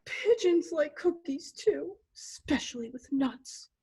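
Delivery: slow, whispery pitiful pigeon; hushed, hard-done-by pigeon voice; sulking pigeon. Acted. Pigeon sulking after the freak-out.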